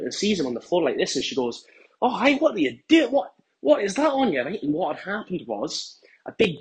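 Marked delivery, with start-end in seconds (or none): 2.0-4.4 high-pitched, appalled questioning; shocked wife. Retold or imitated. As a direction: imitate a wife walking in on a mess. Wife finds husband covered in mayonnaise.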